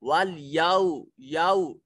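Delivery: याउ as या then उ, never यौ; correct pronunciation. Shown as a demonstration. Teacher shows याउ, not यौ. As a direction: split याउ in two, slow. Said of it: "इसको यौ नहीं पढ़ेंगे".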